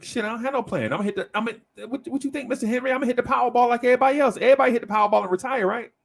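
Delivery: high, fast, cartoonish rant about the Powerball; goofy excited. Client to Mister Henry about the lottery, high and fast. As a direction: rattle it off like a clueless dreamer. Client admits no plan, jokes.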